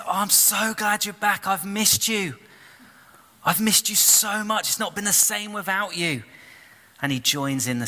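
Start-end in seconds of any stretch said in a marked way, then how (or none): 0.1-6.2 high, bright-voiced brotherly joy; delighted. Older brother hugs returning brother, overjoyed and high. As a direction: gush with relief at his return. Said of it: "he's so delighted".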